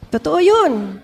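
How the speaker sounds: ordinary talk, nothing marked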